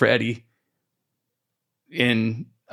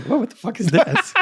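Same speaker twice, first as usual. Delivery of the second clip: laughing through a shocked whoa; mock shock, breaking into laughter; laughing at a stranger's hit list; laughing disbelief; 7 semitones higher than usual. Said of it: "people would be like".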